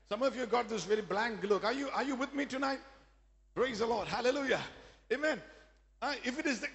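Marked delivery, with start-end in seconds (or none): none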